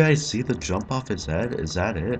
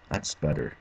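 normal talking voice

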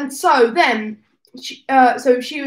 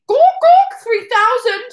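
higher, louder, slow, laughing through it; hammed-up loud announcement; 10 semitones higher, 7 dB louder, slower than usual. Shown as a demonstration. Reading a chat request aloud to the stream, loud and laughing. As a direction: stretch out Gawk Gawk three thousand, loud and laughing. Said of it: "I'm gonna say".

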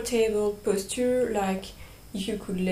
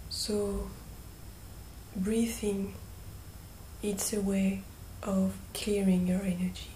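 guided meditation; 9 dB quieter than usual. Real, not acted. Guide leading listeners through breathing and calling on angels, hushed meditation voice. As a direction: gently guide them, leaving long silences between phrases.

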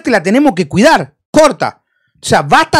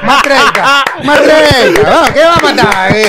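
laughing through the words, a bit louder; 2 semitones higher, 3 dB louder than usual. Real, not acted. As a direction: chuckle it out, amused by the joke. Repeating the age joke.

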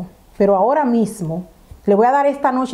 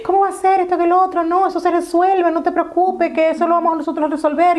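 high-pitched, anxious reassurance; retold flustered man; 8 semitones higher than usual. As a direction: imitate a rattled man smoothing things over, much higher. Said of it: "Ese hombre se puso blanco". (imitando a un hombre nervioso, voz aguda)

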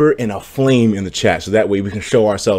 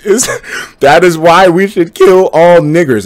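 slow read through laughter; laughing read-out; 5 semitones higher, 7 dB louder, slower than usual. Real, not acted. Streamer reading a shocking chat message aloud, laughing. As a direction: read the message while cracking up.

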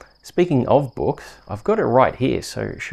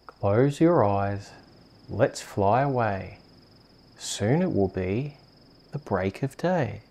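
bedtime story reading. Acted. Host reading a bedtime rhyme to a sleepy child. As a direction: lull a little one to sleep.

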